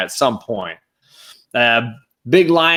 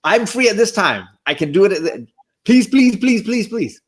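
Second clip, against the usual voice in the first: high, breathless-eager rush of pleading; mock-frantic, high-pitched plea; eager begging; 8 semitones higher than usual. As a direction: beg excitedly like a kid wanting in. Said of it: "I instantly texted him. I'm like".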